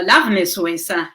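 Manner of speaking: ordinary talk, nothing marked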